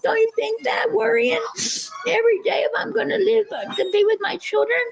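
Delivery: choked-up protest, near sobbing; tearful pleading. Real, not acted. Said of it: "I don't wanna cry".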